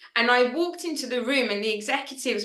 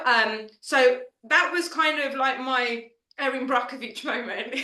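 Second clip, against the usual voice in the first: usual speaking voice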